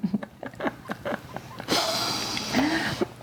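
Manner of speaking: high airy giggle with no voice; breathless laugh. Real, not acted. Host laughing at her fluffer nutter mix-up, airy giggle. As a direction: let out a silent high giggle.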